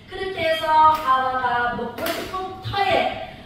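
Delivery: ordinary talk, nothing marked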